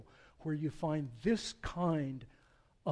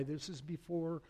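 normal talking voice

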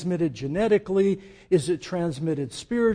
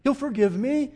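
flippant past self; 7 semitones higher, 4 dB louder than usual. Retold or imitated. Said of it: "Because I thought".

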